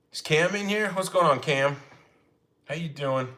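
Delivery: a slipped New York "How you doing?", Sopranos-style; greeting Cam in the chat, a touch of New York accent. Real, not acted. Greeting Cam on the live, unplanned light New York accent. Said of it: "I said that like I'm from New York".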